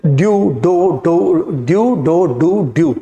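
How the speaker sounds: due, doe, do read out in turn; saying the three words. Shown as a demonstration. Teacher reading the word list to students, sounding each out. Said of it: "इसकी pronunciation है ड्यू".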